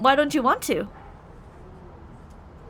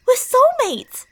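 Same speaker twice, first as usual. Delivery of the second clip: higher and louder, gushing; excited insistence; 9 semitones higher, 6 dB louder, slower than usual. Acted. Obsessed childhood friend insisting to the listener, excited. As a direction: burst out with it like it's obvious.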